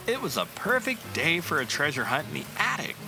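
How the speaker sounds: usual speaking voice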